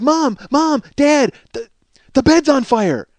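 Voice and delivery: high, loud urgent calling; children's panic. Retold or imitated. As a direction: imitate kids waking parents in alarm. Children waking their parents.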